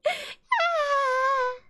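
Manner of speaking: high squealing mock scream; playful imitation of getting scared; retold shriek of fright. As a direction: squeal like you saw a spider. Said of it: "I was like".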